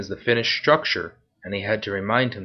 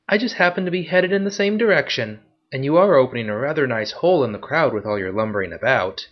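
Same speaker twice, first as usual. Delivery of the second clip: much higher, playful female voice; teasing woman; 4 semitones higher than usual. Acted. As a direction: tease him as the sly pickpocket. Woman teases Marcus.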